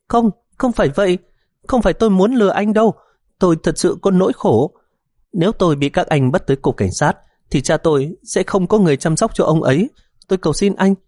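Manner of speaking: high, crying insistence; tearful pleading. A narrator's reading. Daughter pleading with the policeman, tearful high begging. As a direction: plead desperately through tears. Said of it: "nước mắt giàn giụa, năn nỉ ỉ ôi".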